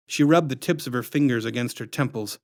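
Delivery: ordinary delivery, nothing marked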